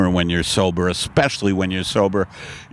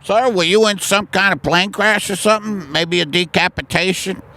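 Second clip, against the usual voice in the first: high cheeky student; 9 semitones higher than usual. Acted. Student questioning the teacher.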